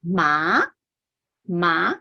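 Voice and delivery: ma rising upward; ma che sale verso l'alto; second tone. Shown as a demonstration. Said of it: "the second tone is".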